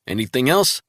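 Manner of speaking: crisp agent reporting in; colleague on the phone. Acted. Travell briskly reporting in to Resnick by phone.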